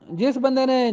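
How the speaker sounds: ordinary delivery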